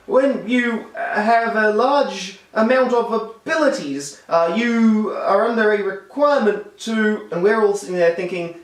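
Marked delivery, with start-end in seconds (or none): 0.1-7.3 mock mumbling, slow and higher, laughing through it; bungling Uncle Ben. Reviewer mocking the reworded movie line, slow bumbling mumble. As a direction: fumble through the clumsy rewrite like a lost uncle. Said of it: "have him mumble and bungle his way".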